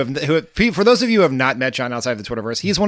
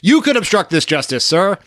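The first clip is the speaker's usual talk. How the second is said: higher-pitched mock suggestion; eager TV host egging on; 5 semitones higher, 4 dB louder than usual. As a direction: lightly play the helpful, deferential host. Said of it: "they did try to encourage Donald Trump".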